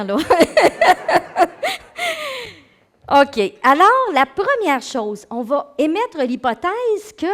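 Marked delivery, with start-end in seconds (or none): 0.2-2.5 loud, high burst of laughter. Real, not acted. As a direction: crack up at yourself. Laughing at her own accent. (grand éclat de rire aigu)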